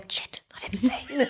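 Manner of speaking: hushed guilty whisper. Retold or imitated. Admitting to friends she never saw him, hushed whisper. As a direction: whisper the secret thought. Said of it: "inner thought whispered".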